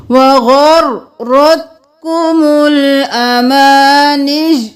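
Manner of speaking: وغرتكم الأماني recited very slowly, vowels stretched; slow recitation. Shown as a demonstration. Teacher models the corrected verse in slow chant. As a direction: draw out وغرتكم الأماني like tajweed. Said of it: "غر اور پھر رت".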